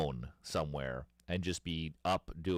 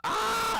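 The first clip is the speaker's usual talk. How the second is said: very high, loud hammed-up cry; mock gagging yell; 6 dB louder than usual. First shot of vodka, loud high mock yelp. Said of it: "And I was like".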